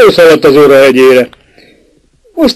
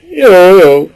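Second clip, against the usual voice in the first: indifferent; 4 semitones higher than usual. A narrator's reading. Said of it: "felelte közömbösen".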